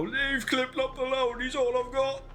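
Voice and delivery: high, slow, pleading cry; Carver pleading. Retold or imitated. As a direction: beg desperately for the horse. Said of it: "Carver come out of his tent and go".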